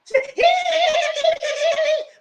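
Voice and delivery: high, loud squealing imitation; Mel getting upset. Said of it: "only get loud when she get upset".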